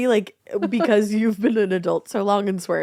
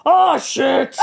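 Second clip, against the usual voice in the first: put-on laughing old man shout; 5 semitones higher, 4 dB louder than usual. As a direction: bark the curse like a grumpy old man. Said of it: "in an old man voice".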